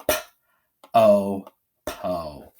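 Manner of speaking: "po" in pieces, with a full oh; long O. Shown as a demonstration. Teacher reading an open syllable, long oh in "po". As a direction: sound out "P, o", then blend "po". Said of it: "read it with a long O".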